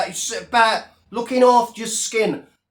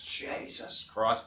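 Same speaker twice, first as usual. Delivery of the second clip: whispered groan; 11 semitones lower, 14 dB quieter, slower than usual. Real, not acted. Complaining about freezing cold.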